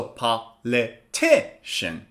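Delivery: "politician" slowly split, TI strongest; slow four-beat "politician" with TI stressed; stress on third syllable. Shown as a demonstration. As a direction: hit TI in "politician", pausing between syllables. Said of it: "stress is on the third syllable".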